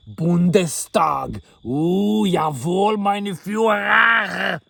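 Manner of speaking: slow barked shout of jawohl; slow mock-German shout; mock German officer. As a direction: drag it out like a hammed-up soldier saluting. Mocking German parliament.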